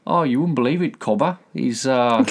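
hammed-up Australian telling a mate; acted Australian caller. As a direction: play the croc victim ringing for help. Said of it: "like, he called up and".

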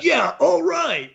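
high, slow approving voice; slow kid-like cheer; impressed kids. Retold or imitated. Kids approve of the naked headmaster, high and slow. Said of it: "the kids are like".

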